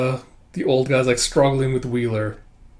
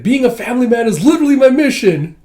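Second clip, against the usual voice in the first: high, loud mock indignation; exasperated retort; 11 semitones higher, 8 dB louder than usual. Twilight retorts to teasing about family, loud exasperation. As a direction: protest it at full volume, comically.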